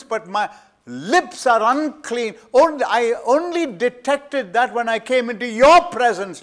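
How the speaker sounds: loud, high, impassioned sermon voice; thundering pulpit preacher; full-force preaching. Acted. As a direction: preach it with rising fire. Isaiah confessing, preached.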